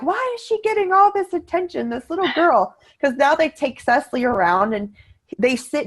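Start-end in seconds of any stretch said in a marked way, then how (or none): 0.0-2.7 high, whiny imitation of jealous parents; a sarcastic impression of the pissed-off parents; mock annoyed parents. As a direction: mimic grumbling parents in line. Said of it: "They're so mad, and they're like".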